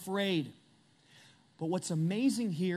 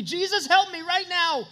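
higher, louder cry for help; louder, higher desperate plea; 9 semitones higher, 8 dB louder than usual. Retold or imitated. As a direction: plead to Jesus in a panic. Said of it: "I have cried out desperately".

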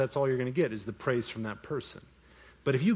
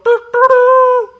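loud, high trumpet blare held long; mock trumpet fanfare; 16 dB louder, slower than usual. Preacher mocking showy givers, mock trumpet fanfare. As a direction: blast out a sung trumpet call. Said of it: "do not trumpet it to the world".

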